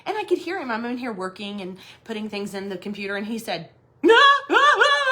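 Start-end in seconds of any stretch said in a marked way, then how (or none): 4.0-5.0 string of high, loud shrieks; mock-frantic repeated screaming; imitated panicked screams. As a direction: mimic a husband yelping at a snake. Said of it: "He's back there jumping around".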